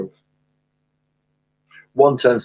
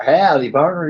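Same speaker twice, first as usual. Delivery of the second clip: louder put-on Southern greeting; attempted Southern drawl; 5 semitones higher, 4 dB louder than usual. Shown as a demonstration. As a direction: answer with a Southern cowboy drawl. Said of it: "my attempt at a Southern draw".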